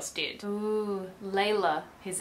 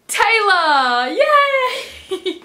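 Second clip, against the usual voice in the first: much higher, louder gleeful cry with a laugh; delighted squeal; excited cheer; 9 semitones higher, 8 dB louder, much slower than usual. Real, not acted. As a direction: greet him like a long-lost friend. Cheering a favourite character's return.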